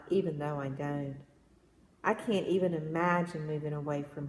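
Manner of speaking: measured, lilting poetry read-aloud; gentle storytelling poem voice. Acted.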